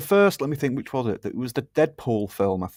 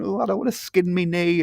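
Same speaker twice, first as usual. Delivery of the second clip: whiny footballer; 7 semitones higher than usual. Retold or imitated. Mocking modern footballers, whiny higher voice. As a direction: whine it like a pampered player.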